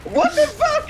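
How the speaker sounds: sudden high shriek; screaming hype voice. Acted. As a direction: shriek it out for a joke. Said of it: "your screaming hype voice".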